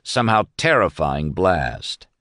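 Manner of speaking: usual speaking voice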